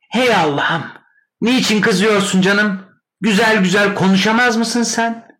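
very high, loud protest; exasperated sister. A narrator's reading. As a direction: plead shrilly with your shouting brother. Sister quarrels back in acted scene. (tiz sesle sitem eden abla)

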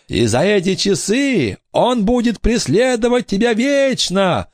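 high, wailing threat; ghostly curse. A narrator's reading. Ghost girl threatens pirate with haunting, high wailing voice. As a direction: pronounce a curse like a vengeful phantom.

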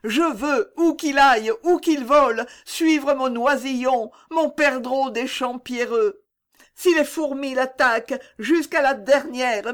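fierce high voice of a mother's despair; desperate mother. A narrator's reading. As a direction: swear to follow her child anywhere. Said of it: "s'écrie la pauvre mère".

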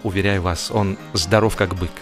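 normal talking voice

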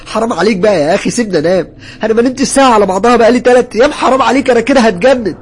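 high, loud, frantic pleading shout; angry yelling. A narrator's reading. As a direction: yell desperately at a tormentor. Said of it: "رديت عليه بزعيق وعصبية". (زعيق بعصبية وانهيار)

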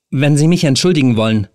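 trying to sound calm but scared; fear in every syllable. A narrator's reading. Helen to the man blocking the door, fear seeping through. Helen tries to excuse herself.

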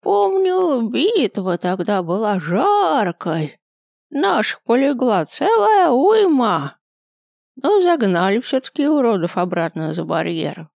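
high, creaky, slow ancient grandpa; very old man. A narrator's reading. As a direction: groan it out like a frail old man. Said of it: "прокряхтел дед".

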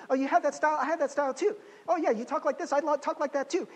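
high, gushing middle-schooler trying to fit in; eager people-pleaser. Retold or imitated. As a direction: chirp agreement to impress friends. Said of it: "and like".

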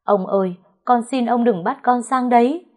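mildly wailed, high-pitched plea; wailing plea. A narrator's reading. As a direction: beg not to be sent away. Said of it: "Nó dập đầu xuống đất gào lên".

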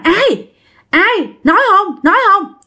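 high, sharp demands for an answer; insistent interrogation. Acted. Aunt questioning the possessed mother, demanding. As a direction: press for an answer in short high bursts.

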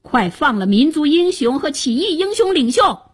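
high-pitched forceful order; urgent command. A narrator's reading. Hero ordering the minister to free captives, commanding. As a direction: demand their release at once.

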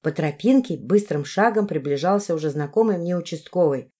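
usual speaking voice

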